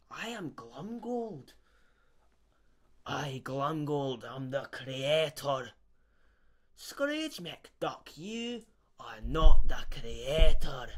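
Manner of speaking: slow, loud sneering Scottish villain; Glomgold. Acted. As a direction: gloat like a cartoon villain, slowly. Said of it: "I am Glumgold".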